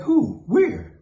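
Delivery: high, loud who and where; eager demand. Retold or imitated. Narrator eager for a fight, high excited questions. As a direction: jump at the chance.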